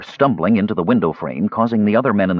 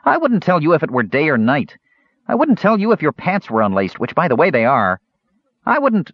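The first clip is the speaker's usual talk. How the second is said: high-pitched, jeering kender voice; high, cheeky jeer, cut off at the end; taunting; 7 semitones higher, 3 dB louder than usual. A narrator's reading. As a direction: keep taunting him, pitched well up. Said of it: "the Kender taunted".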